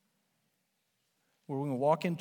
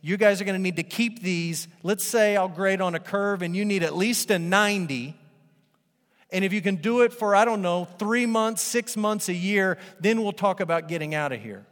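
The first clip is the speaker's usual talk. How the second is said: demanding God; 6 semitones higher, 8 dB louder than usual. Retold or imitated. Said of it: "He didn't say".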